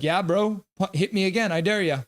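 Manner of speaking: cocky taunt. Retold or imitated. Streamer voices McMichael daring Struble to hit him again, a cocky mock taunt. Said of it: "McMichael's just like".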